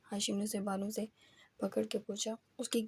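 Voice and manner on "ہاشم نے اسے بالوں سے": ordinary delivery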